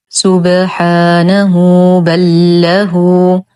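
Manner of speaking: slow سبحانه بل له, lengthened "hu"; joined ha with silah. Shown as a demonstration. Teacher reciting the join, drawn-out سبحانه بل له. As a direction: chant سبحانه بل له, long u on each ه. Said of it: "نتم ضمة الهاء ونصلها بصلة صغرى".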